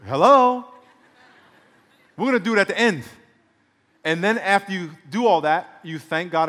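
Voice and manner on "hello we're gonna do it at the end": loud, high questioning hello; incredulous God. Acted. God interrupts a demanding prayer, incredulous. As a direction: call it out as an indignant God. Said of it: "And God's like".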